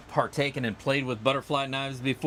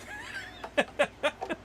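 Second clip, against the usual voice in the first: airy giggle; high unvoiced laugh; breathy high laugh; 5 dB quieter than usual. Real, not acted. Laugh after joke.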